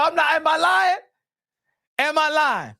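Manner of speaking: ordinary delivery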